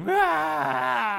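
put-on tired hungry two-year-old on the ground, high wailing cry; toddler wail. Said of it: "lay on the ground and be like".

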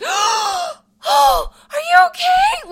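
very high, loud, overdone gasp of concern; mock a melodramatic stranger; dramatic concerned lady. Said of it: "Like, so dramatic".

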